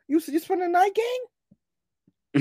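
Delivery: imitating roommate. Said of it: "He like".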